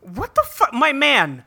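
high, incredulous outburst at the pizza; shocked disbelief. Real, not acted. Reacting to a photo of egg pizza, shocked disbelief.

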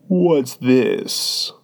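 hollow voice of a sleepy lion; deep, hollow tone. A narrator's reading. Sleepy lion blinks at Alice, deep and hollow. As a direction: toll it out like a great bell. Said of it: "speaking in a deep, hollow tone".

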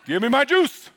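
put-on demanding toddler, loud and laughing; whiny little kid wanting juice; a toddler asking for his juice.

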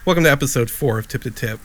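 brief hammed-up show intro; podcast intro. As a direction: announce the show like a host. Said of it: "You already did that".

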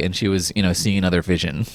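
usual speaking voice

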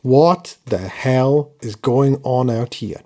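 low, slow coach's growl; lightly voiced old coach; mock Vince Lombardi. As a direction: quote the coach in his voice, slowly. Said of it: "In the words of the immortal Vince Lombardi".

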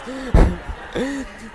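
amused laugh at his own slip. Real, not acted. Preacher amused by his own accent slip, laughing. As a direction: break off into a laugh.

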